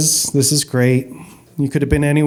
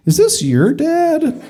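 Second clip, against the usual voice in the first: nosy stranger; 12 semitones higher, slower than usual. Retold or imitated. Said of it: "my kids and being like".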